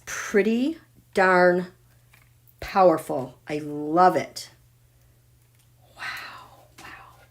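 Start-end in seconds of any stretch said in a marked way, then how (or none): 6.0-7.2 barely audible amazement; real hushed awe; awed whisper. Real, not acted. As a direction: breathe it out in wonder. Awed by the chart.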